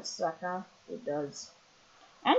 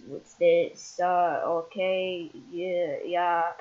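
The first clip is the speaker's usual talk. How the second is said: ordinary delivery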